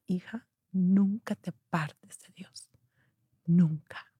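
slow, breathy whisper of a dying mother; hushed whisper. Retold or imitated. Dying mother whispers to her daughter in the ambulance, slow hushed whisper. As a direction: whisper it slowly, as if with her last strength.

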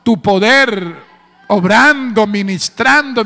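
loud, driving church preacher; full-force preaching. Real, not acted. Preacher rousing the congregation, full force. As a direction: preach it out loudly.

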